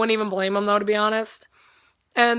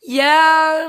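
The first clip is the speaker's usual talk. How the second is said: giddy yeah; 6 semitones higher, 8 dB louder than usual. Retold or imitated. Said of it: "And I'm like".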